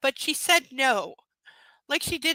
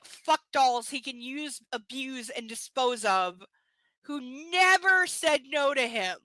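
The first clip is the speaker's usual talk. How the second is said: ordinary talk, nothing marked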